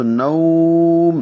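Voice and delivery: نوم with the "au" glide drawn out long; elongated leen. Shown as a demonstration. Teacher shows students the pause on نوم, elongated. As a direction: hold the vowel of نوم before closing the meem. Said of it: "لمبا کریں گے".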